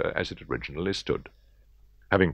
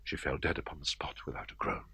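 hushed whisper; 12 dB quieter than usual. A narrator's reading. Narrator whispering the murder's quiet end. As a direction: whisper it, barely voiced.

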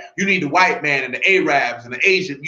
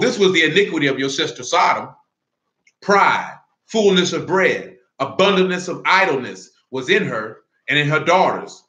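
scripture reading; 4 semitones lower than usual. Acted. Reads scripture before preaching.

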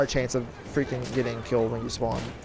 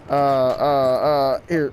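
panicked stammer; 4 dB louder, much slower than usual. Real, not acted. Sudden enemy encounter.

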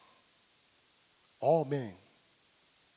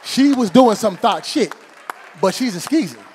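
loud, high punchline shout; yelled punchline; 6 semitones higher, 16 dB louder than usual. Real, not acted. Comic yelling out the skeezer punchline to the crowd.

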